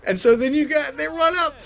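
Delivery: choked-up, pitch climbing very high; close to crying. Real, not acted. Telling a friend about his family's reunion, tearful. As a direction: fight back real tears as the voice rises. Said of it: "it's gonna make me cry".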